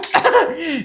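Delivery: real amused laughter; high laugh. Real, not acted. Laughing at own story.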